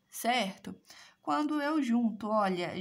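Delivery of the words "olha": usual speaking voice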